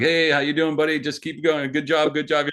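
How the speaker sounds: high, sustained placating voice; mock friendly encouragement. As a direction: imitate nervously humouring a scary guy. Acting out overly friendly chat to opponent.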